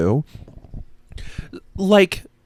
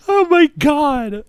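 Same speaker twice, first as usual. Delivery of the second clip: laughing; 4 dB louder, slower than usual. Real, not acted. Overcome laughing at own joke.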